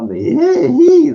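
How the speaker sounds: high, loud imitation cackle; put-on squealing laugh at a fool; mocking laugh. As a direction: mimic a creature's shrill mocking giggle. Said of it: "laughing at him".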